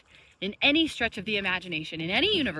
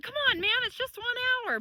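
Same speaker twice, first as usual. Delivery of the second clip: pleading whine; 12 semitones higher, much faster than usual. Retold or imitated. Begging friend to wait for her.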